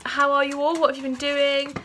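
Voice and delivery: usual speaking voice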